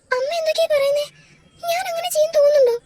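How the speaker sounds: high, fast, disbelieving plea; shocked protest. Acted. Wife protests to her mother-in-law, high and shocked. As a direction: push back hard, hurt and stunned.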